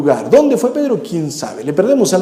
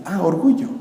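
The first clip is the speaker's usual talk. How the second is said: usual speaking voice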